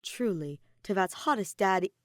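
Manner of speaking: plain reading voice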